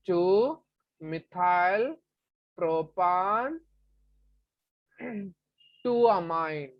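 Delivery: very slow, word by word for notes; slow dictation. Shown as a demonstration. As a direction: spell it out slowly for students to write. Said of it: "What is that?".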